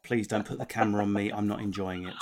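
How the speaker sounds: low, softly feigned camera-shy protest; mock reluctance. Comic mocking celebrities who feign dislike of cameras, mock reluctance. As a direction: pretend to shy away, voice dropped low.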